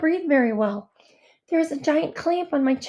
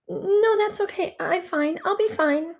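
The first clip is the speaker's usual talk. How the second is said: higher-pitched insistence on being fine; reassuring; 5 semitones higher than usual. A narrator's reading. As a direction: brush off the concern in a lifted voice. Child brushes off grandmother's offered medicine.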